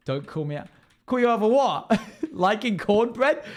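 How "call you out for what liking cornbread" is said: laughing high and loud. Real, not acted. Streamer laughing at a chatter over cornbread. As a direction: giggle through the teasing question.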